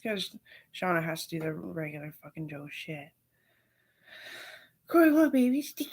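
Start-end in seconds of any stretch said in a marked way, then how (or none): 4.9-5.9 put-on high, loud cooing baby talk. Offering a toddler a treat in loud high baby talk. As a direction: coo it like talking to a toddler.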